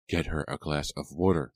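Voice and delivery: ordinary delivery, nothing marked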